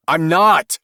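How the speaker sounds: loud, high, heated denial; hot protest. A narrator's reading. Husband denying to his wife that he lied, heated and loud. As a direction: fling the denial back at her. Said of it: "Craig protested hotly".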